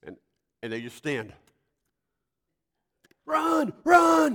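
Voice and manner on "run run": high, very loud cheering shout; hollering. Retold or imitated. Spectators to a kid standing after a first hit, hollering. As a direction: holler it like an excited crowd. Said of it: "everybody's hollering".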